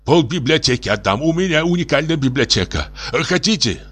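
high, desperate pleading; tipsy despair. A narrator's reading. Professor offers his library for a coat, despairing high plea. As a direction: wail and bargain in despair. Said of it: "вскричал пьяненький профессор в величайшем горе".

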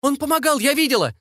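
very high, insistent denouncing; high-pitched accusing witness; shrieked accusation. A narrator's reading. Accusing a man before crowd.

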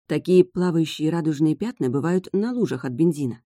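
plain reading voice

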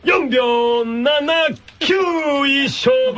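upbeat announcer hyping the contest; game show host. Acted. Hosting a tongue-twister contest for the radio audience, as an upbeat announcer. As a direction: call it like a TV quiz host over music.

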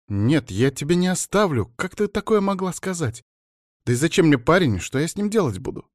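high-pitched, indignant refusal; upset protest. A narrator's reading. Daughter objecting to mother's plan, upset protest.

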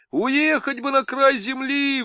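high, slow, wailing lament; howling. Acted. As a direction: howl it with longing. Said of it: "выла Полина".

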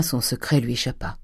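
usual speaking voice

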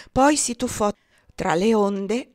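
ordinary delivery, nothing marked

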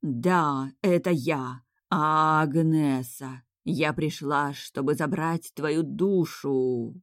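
slow, drawn-out spectre voice; hollow, stretched-out haunting moan; ghostly howl. Pretend. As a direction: wail like a ghost come for a soul. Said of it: "утробно завыла".

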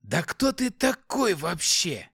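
slow, high suspicious demon hiss; suspicious demonic hiss. Acted. Demon confronts the hero.

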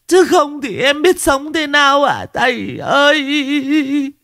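very high, slow wailing woman's cry; drawn-out high keening, clinging and begging; wailing plea. Acted. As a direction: howl it out, begging the master to save her husband. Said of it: "bù lu bù loa".

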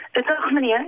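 put-on high, hesitant boy's voice; high-voiced boy in a story; young boy. A narrator's reading. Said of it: "zei Job".

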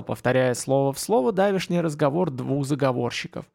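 ordinary delivery

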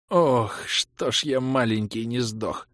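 slow, pained croak; wheezed groan. A narrator's reading. Hero getting up after a punch, wheezing. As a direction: drag it out hoarsely. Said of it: "прохрипел я".

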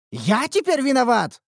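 high, loud indignant outcry; offended blacksmith exclaiming; indignant exclamation. A narrator's reading. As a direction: protest the blame hotly. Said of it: "возмущённо воскликнул кузнец".

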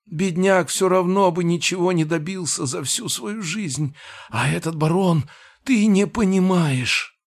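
high, frantic little gnome. Acted. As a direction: panicky high-pitched creature voice. Gnome character voiced by narrator.

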